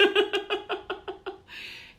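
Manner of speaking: high, light self-conscious giggle. Real, not acted. Laughing at herself while greeting viewers.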